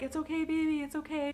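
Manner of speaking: lightly imitated high soothing coo; a hint of comforting a newborn; soothing baby. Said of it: "I'm like".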